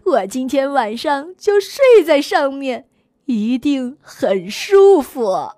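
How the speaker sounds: put-on slow, low old uncle voice; deep, unhurried grandpa ox.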